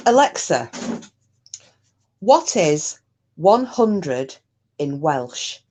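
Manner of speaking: slow, deliberate voice command; talking slowly to a device; slow clear command. Real, not acted. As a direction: spell it out slowly for the smart speaker.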